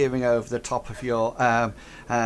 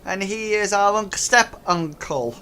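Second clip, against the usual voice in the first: higher slow goblin voice; goblin explaining; 7 semitones higher than usual. Acted. Goblin explaining the loss to the players. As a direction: explain it carefully.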